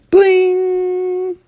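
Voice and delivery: high, sustained "bling"; imitated chime. Storyteller signals time to turn the page, high sung chime. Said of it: "hear the chimes ring like".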